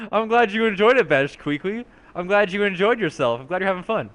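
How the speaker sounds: pleased little character in a put-on voice; goofy character voice, lilting pitch; put-on sidekick character. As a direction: chime in as an odd, cheerful sidekick. Second character voice interjects.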